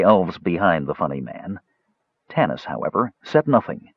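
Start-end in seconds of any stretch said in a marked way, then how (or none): none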